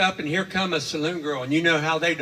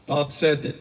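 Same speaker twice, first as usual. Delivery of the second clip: ordinary delivery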